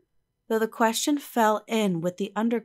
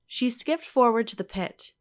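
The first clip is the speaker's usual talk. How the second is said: plain reading voice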